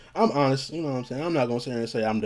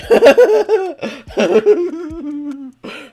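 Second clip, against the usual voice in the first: loud, high burst of laughter; real laugh at your own story; laughing hard; 11 dB louder than usual. Real, not acted. Laughs at his own memory.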